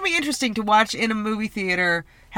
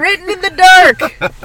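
dramatic; 9 semitones higher, 9 dB louder, slower than usual. Pretend. Dramatic echo of title.

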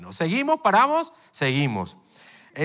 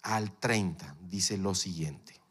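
ordinary talk

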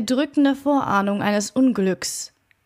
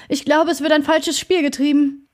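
hoarse; 5 semitones higher, 4 dB louder than usual. A narrator's reading. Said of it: "sagte Poole heiser".

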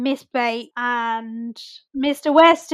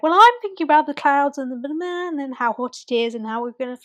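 quick imitation of a prattler; rambling chatter; 3 semitones higher, faster than usual. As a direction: babble on without stopping. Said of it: "starts chatting shit".

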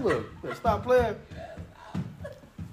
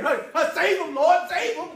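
mock panicked plea; 9 semitones higher than usual. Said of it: "I'ma hop up out the bed".

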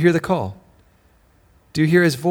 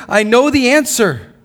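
proclaiming; 9 semitones higher, 7 dB louder than usual. Retold or imitated. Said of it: "he's saying".

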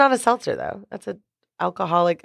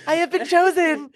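Toy Story alien; 6 semitones higher, slower than usual. Retold or imitated. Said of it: "in Toy Story when he's like".

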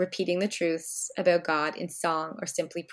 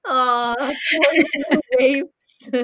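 mock pity; 6 semitones higher, 7 dB louder, much slower than usual. Mock pity for hungry children.

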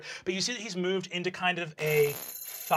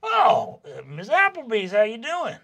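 high, loud oh, then friendly; hearty greeting; 5 semitones higher, 4 dB louder than usual. Acted. Jawbone looking over to greet Miss Applebee's, hearty. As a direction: open on a high loud burst, greet keenly.

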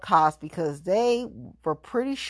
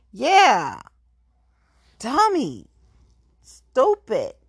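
taunting; 6 semitones higher, 4 dB louder, much slower than usual. Real, not acted. Taunting insults.

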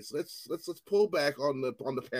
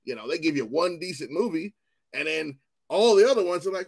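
usual speaking voice

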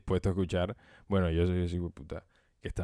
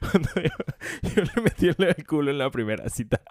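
laughing through the words in disbelief; stunned laughter; 8 semitones higher than usual. Real, not acted. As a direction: laugh out the line. Laughing while repeating friend's story.

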